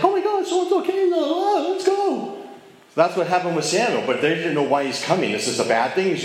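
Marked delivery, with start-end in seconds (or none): 0.0-2.4 squealing high, starstruck fan voice; mock excited crowd. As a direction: gush like a starstruck fan spotting a celebrity. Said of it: "'Cause it's like".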